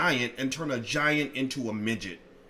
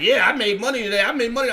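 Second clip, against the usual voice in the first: excited student; 8 semitones higher, 7 dB louder, faster than usual. Retold or imitated. Students' retold excited reply.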